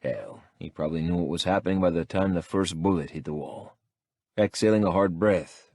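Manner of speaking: ordinary delivery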